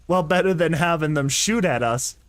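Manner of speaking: usual speaking voice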